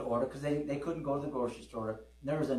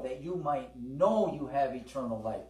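ordinary talk, nothing marked

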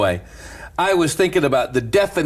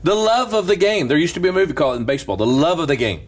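raised, ringing sermon voice; preaching; 6 semitones higher than usual. Acted. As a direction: preach it out to the church with fervour. Preaching at full force.

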